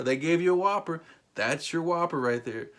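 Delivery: ordinary talk, nothing marked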